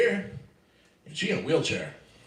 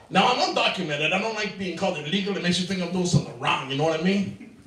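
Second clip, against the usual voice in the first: put-on Sanchez Mexican accent; 3 semitones higher than usual. Comic playing alter ego Sanchez, a mock Mexican accent. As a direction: slip into a hammed-up Mexican accent for the bit. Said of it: "and I talk like this".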